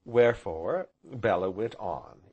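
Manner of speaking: usual speaking voice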